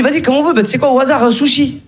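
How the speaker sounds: lightly voiced pal suggesting sushi; imitating the friend. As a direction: play the buddy pretending it's random. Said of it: "il me dit".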